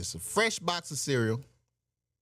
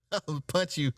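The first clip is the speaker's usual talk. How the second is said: laughing threat. Retold or imitated.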